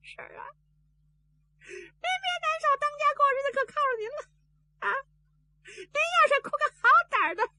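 high, tearful consoling wail; crying while consoling. A narrator's reading. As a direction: keep sobbing as you comfort her. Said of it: "他一边哭， 他一边还劝". (一边哭一边尖声劝)

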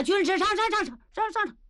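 high urgent rallying call; rally the troops, high and insistent. Acted. Niu Gao calling his men to charge, high and urgent.

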